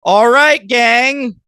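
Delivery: high, loud "All right, gang"; loud call-out. Real, not acted. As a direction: call the gang together loudly. Calling out podcast sign-off.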